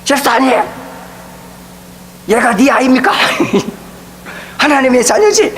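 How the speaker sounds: the pastor losing his temper, retold; retold scolding. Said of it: "저도 한성질 하잖아요".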